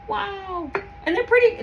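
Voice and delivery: high, breathless admiration; awed. Real, not acted. Admiring the jars, awed. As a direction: marvel at the jars.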